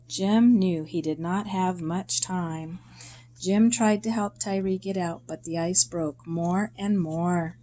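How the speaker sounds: usual speaking voice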